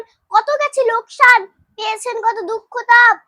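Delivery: a mother's pained reproach; reproachful mother. A narrator's reading. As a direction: chide a child, voice lifted. Mother chides her crying son.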